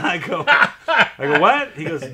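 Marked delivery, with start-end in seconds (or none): 0.1-1.4 laughing. Real, not acted. Laughing while retelling the story. 1.4-1.7 high, loud disbelieving What?; shocked. Retold or imitated. Retells his shocked reaction.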